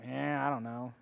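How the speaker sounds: soft, low, can't-be-bothered mumble; imitating a bored, apathetic reply; mock indifference. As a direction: shrug the answer off lazily. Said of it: "And they're like".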